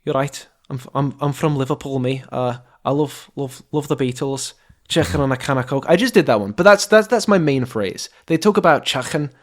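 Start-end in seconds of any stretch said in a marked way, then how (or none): 0.0-5.9 throaty Liverpool accent, put on; Scouse accent. Shown as a demonstration. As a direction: do a Scouser, rasping the "k" in Chicken. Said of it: "do a Scouse accent".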